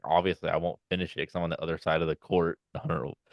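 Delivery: ordinary talk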